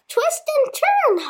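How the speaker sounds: high honked order; honking goose. A narrator's reading. Goose honking at Wilbur, high honk. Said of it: "honked the goose".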